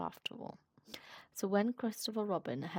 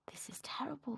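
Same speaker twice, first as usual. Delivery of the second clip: worried whisper; 4 semitones higher, 6 dB quieter than usual. Acted. Piglet panicking inwardly.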